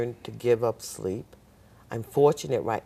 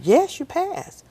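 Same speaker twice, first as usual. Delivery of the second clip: slow instructor voice; 7 dB louder, slower than usual. Retold or imitated. Instructors confirm she passed.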